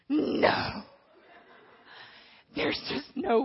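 refusal. Acted. Refusing God's command.

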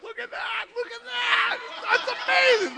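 very high, loud excited shout; amazed shouting. Retold or imitated. Telling the team to look at her name tag, amazed shout. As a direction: reenact blown-away amazement at full pitch. Retelling team's amazement at Rhonda sighting.

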